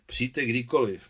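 ordinary delivery, nothing marked

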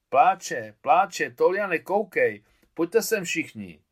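put-on excited boy calling everyone over, loud and high; loud excited call to the gang; shouting. A narrator's reading. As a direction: yell it out, waving friends over. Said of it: "zakřičel Miťka".

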